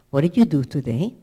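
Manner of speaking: put-on loud, pointed question to a child; parent. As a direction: confront a child who has misbehaved. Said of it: "A good parent also says sometimes to a child".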